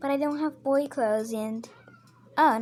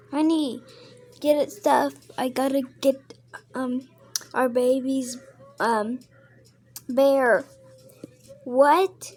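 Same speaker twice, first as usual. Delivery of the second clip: louder, calling to her husband across the house; 5 dB louder than usual. Pretend. Mom calling her husband about the baby's bear, loud. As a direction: raise your voice to call honey.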